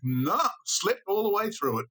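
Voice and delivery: put-on high-pitched, blithe boy; retold nonchalance. Storyteller voicing a boy who slept through it, blithely. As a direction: toss it off high, imitating the boy.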